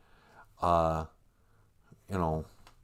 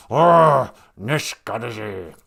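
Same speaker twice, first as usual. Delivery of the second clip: growled word; 8 dB louder, slower than usual. Shown as a demonstration. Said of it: "That's a great word".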